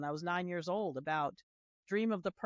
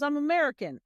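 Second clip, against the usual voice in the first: put-on higher and louder, a kid's proud answer; kid's reply; 8 semitones higher, 7 dB louder than usual. Ten-year-old classmate answering the girl, higher and louder. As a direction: voice the boy blurting his answer. Kid answering in retold schoolyard exchange.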